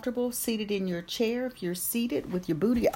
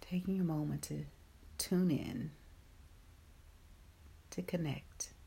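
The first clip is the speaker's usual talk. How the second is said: guided meditation; 5 dB quieter than usual. Acted. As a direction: ease them gently into calm.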